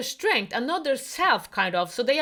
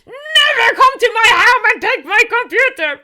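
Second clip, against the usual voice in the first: furious yell, higher and louder; angry shout; 10 semitones higher, 11 dB louder than usual. Retold or imitated. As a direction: shout it at your mother in rage. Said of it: "he was so angry".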